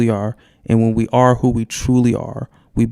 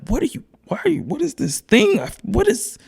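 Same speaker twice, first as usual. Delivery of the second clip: high, stammered mock confusion; acted bafflement; 13 semitones higher, 3 dB quieter than usual. Host voices a person being truly seen, mock baffled. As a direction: play someone thrown off, pitched way up. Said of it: "And you're like".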